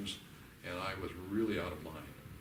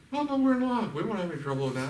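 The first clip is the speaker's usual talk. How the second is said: high, loud and breezy, then rushing; mock starry-eyed couple; 9 semitones higher, 7 dB louder than usual. Counselor mimics a couple in love, high and breezy. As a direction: play a giddy couple brushing it off. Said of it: "they say".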